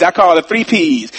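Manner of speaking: ordinary talk, nothing marked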